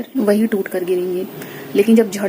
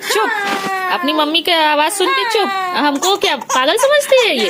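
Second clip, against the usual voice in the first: put-on baby talk; 11 semitones higher than usual. Mother soothing baby.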